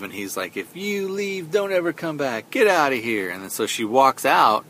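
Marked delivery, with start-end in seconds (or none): 0.6-3.3 mock-angry order, high-pitched at the start; retold angry dismissal. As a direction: act out throwing someone out. Retold conductor throwing her out.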